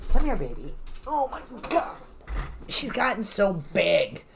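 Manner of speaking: cooing at the dog, pitch jumping up on oh my God; doting pet talk. Real, not acted. Greeting her pug Maya on camera, cooing fondly.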